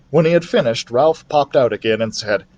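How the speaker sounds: ordinary delivery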